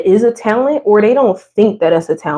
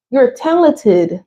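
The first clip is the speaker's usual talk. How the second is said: usual speaking voice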